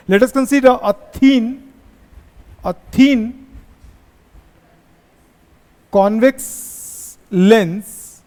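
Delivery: very slow, word by word, for copying notes; slow dictation. Shown as a demonstration. Teacher dictating to the class, very slow and drawn out. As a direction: spell it out slowly so students can write. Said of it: "dictating notes slowly to class".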